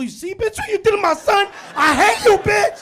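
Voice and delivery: high, loud mock rage at the sofa; furious yelling. Dad screaming at the sofa that hurt his son, loud and high. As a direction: yell at the couch like it's guilty.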